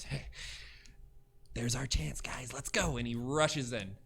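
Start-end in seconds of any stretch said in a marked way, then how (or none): 0.0-3.0 a lightly voiced sneaky goblin; half-whispered goblin urging the others. Goblin whispering to raiders, sneaky urging. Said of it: "as the goblin goes".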